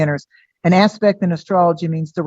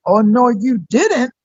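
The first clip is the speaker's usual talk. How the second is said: slow, higher-pitched mock outrage; sassy indignation; 5 semitones higher, slower than usual. Aquarius energy calling someone out, sassy and indignant. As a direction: draw it out with sassy disbelief.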